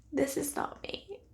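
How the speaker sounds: slow, soft, sorry confession; heartbroken admission. Real, not acted. Vlogger admitting the shade isn't her style, soft and sorry. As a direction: say it as if it pains you. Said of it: "it crushes me to say that".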